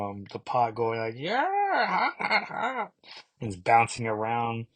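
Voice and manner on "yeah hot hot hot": high squeaky cries of hot; a tiny egg yelping in the pot; mock screaming egg. A boiling egg yelps as it bounces, in a mock high squeak. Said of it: "screams out of the pot".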